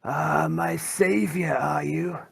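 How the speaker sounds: slow, high-pitched wretched mutant voice; Rufus. Acted. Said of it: "And Rufus goes".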